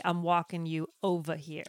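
New Jersey accent. Shown as a demonstration. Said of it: "the correct colloquialism would be".

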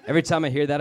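ordinary talk, nothing marked

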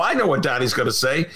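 ordinary delivery

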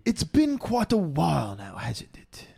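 whispered mock British accent; breathy posh-British tease, whispered. Replying to the Liverpool tease in a whispered British accent. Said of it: "British accents".

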